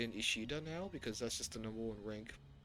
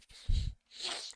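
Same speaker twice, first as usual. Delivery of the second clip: breathy laugh; 6 dB louder than usual. Real, not acted. Amused by game menu design.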